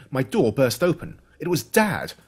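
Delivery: plain reading voice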